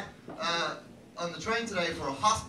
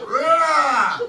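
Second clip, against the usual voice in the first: loud, raised roar; mock roar; 7 semitones higher, 10 dB louder than usual. Comic acting out a Viking on stage, mock roar. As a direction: let out a hammed-up roar.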